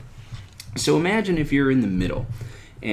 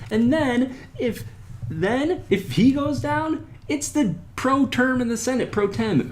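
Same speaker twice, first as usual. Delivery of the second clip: mock disbelief. Explaining succession to the crowd in high mock disbelief. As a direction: play it up, baffled at the chain.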